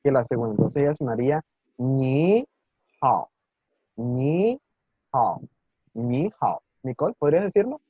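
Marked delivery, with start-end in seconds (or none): 1.8-6.6 "ni" rising in second tone, then "hao" in third tone; "ni hao" with the first syllable lifted and rising, the second dipping; ni hao tone sandhi. Shown as a demonstration. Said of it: "la primera sílaba va a pronunciarse ligeramente más aguda".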